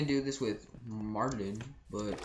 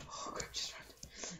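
urgent whisper; 12 dB quieter, slower than usual. Real, not acted. Urgent whisper while fleeing.

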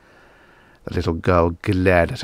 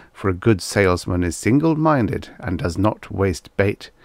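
plain reading voice

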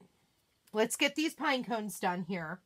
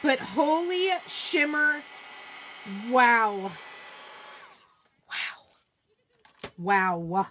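amazed; 5 dB louder, slower than usual. Real, not acted. Amazed at the shimmer result.

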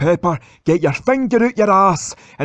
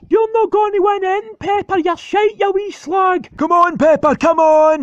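much higher, fast shouting; frantic cheering; 11 semitones higher than usual. Acted. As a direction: scream encouragement in a frenzy. Tug of war cheering.

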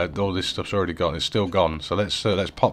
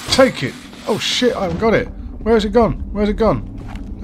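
panicked; 12 semitones higher than usual. Real, not acted. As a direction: scramble in a panic. Panic when axe missing.

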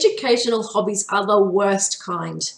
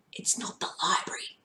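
unvoiced, much softer secret; whisper; 11 dB quieter than usual. A narrator's reading. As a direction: lean in and whisper the warning. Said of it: "Marty whispered".

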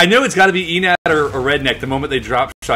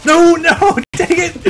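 high, loud cry breaking into laughter; frustrated cry; 13 semitones higher, 4 dB louder, slower than usual. Real, not acted. Gamer crying out after getting killed, frustrated laugh. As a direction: shout your frustration.

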